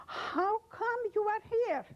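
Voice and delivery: high, slow astonished question; stunned childhood friend asking; surprised friend. Retold or imitated. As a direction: ask it in disbelief, drawn out.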